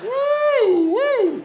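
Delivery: high, loud whoop; mock whoop. Comic mimicking proud smokers with a loud whoop.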